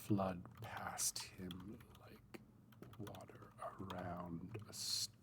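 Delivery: whispered, slow reading of his own prose; soft draft reading. Acted. [name] reading his new draft aloud to viewers, whispered and slow. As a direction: read it under your breath, slowly, testing each line.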